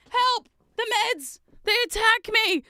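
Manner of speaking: high, loud cries for help; mock panic. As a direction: yelp like you're under attack. Mock panic at attacking meds.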